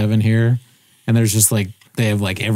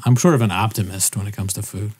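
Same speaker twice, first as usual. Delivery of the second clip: usual speaking voice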